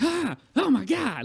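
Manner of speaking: high, loud, drawn-out gasp of disgust; grossed-out shock. Retold or imitated. Retelling seeing a stranger's spider-bite scar, loud, high, grossed-out shock. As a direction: recoil as if the scar is right in your face. Retold reaction to shown scar.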